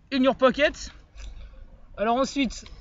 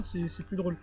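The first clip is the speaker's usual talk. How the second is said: ordinary talk, nothing marked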